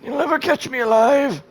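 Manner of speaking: mock defiant escape yell; cartoon fleeing taunt; hammed-up taunt. Mock cry while fleeing enemy.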